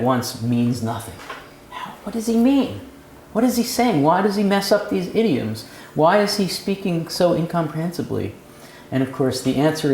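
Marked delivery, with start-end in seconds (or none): 1.7-8.3 puzzled reader. Retold or imitated. Puzzled reader's questions voiced.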